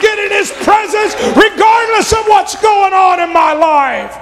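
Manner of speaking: full-force preaching. Acted. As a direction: build it up and proclaim.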